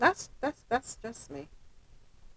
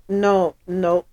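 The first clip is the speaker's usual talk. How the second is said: ordinary delivery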